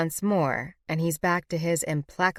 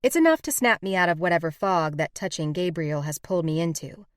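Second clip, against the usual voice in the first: ordinary delivery, nothing marked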